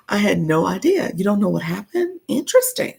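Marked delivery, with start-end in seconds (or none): 2.3-2.9 surprised. Real, not acted. Reacting to viewer comment.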